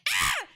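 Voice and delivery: short high mock scream; retold scream. Retelling her scream on the roller coaster, mock yell. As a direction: yelp it like on the ride. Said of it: "I was like".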